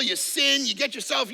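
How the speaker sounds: normal talking voice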